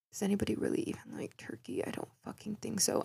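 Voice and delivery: fast conspiratorial whisper; hushed, fast whisper, as if telling a secret; whispered aside. Acted.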